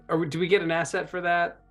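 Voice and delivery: ordinary delivery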